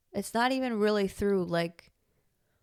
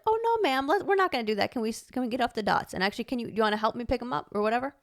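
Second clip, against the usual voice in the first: polite teacher correction; 4 semitones higher, faster than usual. Retold or imitated. Teacher redirecting a little girl off the dots, polite. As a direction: sing out a polite correction up high. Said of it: "that's the polite way".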